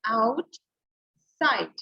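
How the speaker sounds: "आउट", clear pause, "side"; split syllables. Shown as a demonstration. Teacher saying it with all the children, आउट - side split apart. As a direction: model आउट and side as two detached beats. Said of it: "टू times voice आ रही है".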